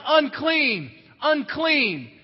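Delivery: loud, falling "Unclean, unclean" called out; shouting unclean. Retold or imitated. Outcast woman warning people in public, calling loudly. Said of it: "you had to walk around shouting".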